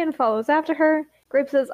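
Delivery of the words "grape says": ordinary delivery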